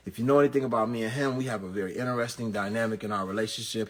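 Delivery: ordinary talk, nothing marked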